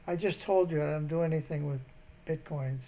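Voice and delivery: normal talking voice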